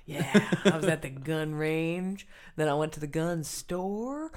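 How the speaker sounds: drawn-out, hammed-up Southern drawl; vague Southern accent. Joking to co-host about shopping for guns, mock Southern accent. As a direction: put on a slow, not-quite-Southern accent. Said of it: "I don't know what that accent is either".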